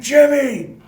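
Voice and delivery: high loud call; yelling the trainer's name. Retold or imitated. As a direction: shout the name down the bench. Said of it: "He says it again".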